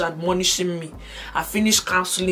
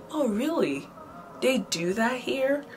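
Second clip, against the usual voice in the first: put-on higher, soft affected American accent; 6 semitones higher, 5 dB quieter, slower than usual. Newly landed traveller acting foreign to a stranger, put-on American accent. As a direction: feign innocent surprise in a fake American accent. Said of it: "I was busy forming accent I did not have".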